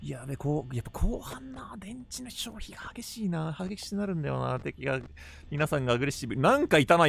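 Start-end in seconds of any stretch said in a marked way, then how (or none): 0.1-4.4 soft, half-whispered worry; quiet muttering. Real, not acted. Gamer watching the battery drain late at night, hushed muttering. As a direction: mutter under your breath to yourself.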